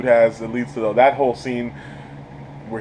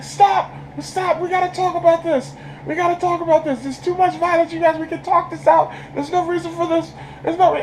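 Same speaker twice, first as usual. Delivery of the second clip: much higher, pleading mock voice; pleading peacemaker. Reviewer voicing Steven begging fighters to stop, high pleading. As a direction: squeak out a nervous plea to stop fighting.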